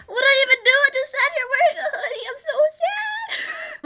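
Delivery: teary pretend complaint; mock sad whine. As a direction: play a wounded fourteen-year-old. Teen whining after security pat-down.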